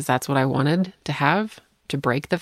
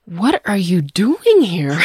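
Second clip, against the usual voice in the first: shocked disbelief; 4 semitones higher, 6 dB louder, slower than usual. Retold or imitated. Producer's shocked reaction retold.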